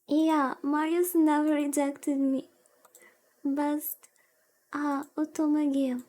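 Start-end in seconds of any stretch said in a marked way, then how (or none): none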